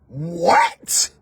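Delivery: normal talking voice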